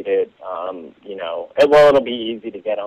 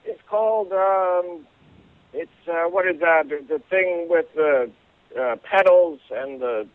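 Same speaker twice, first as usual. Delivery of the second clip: put-on higher old-man voice groping for a word; old man can't recall the flower's name, higher hesitant voice; forgetful old man; 7 semitones higher than usual. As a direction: fumble for the word like a muddled old guy. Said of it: "And he says".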